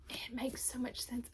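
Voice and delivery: hushed awed whisper. Real, not acted. Praising Mia's writing to viewers, in a whisper. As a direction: breathe it out in quiet awe.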